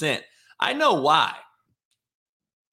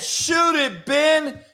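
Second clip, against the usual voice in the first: high, loud shout at a teammate; imitating a shout; 12 semitones higher, 4 dB louder, much slower than usual. Kyrie shouting at Simmons to shoot, loud and high. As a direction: yell it like a frustrated teammate. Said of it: "he said".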